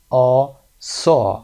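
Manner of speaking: drawn-out "آ", then "saw" with the same AW; long AW vowel. Shown as a demonstration. Teacher isolates the vowel for learners, long AW. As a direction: stretch the AW of "saw" slowly and loudly. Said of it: "و صدای بعدی صدای او هست".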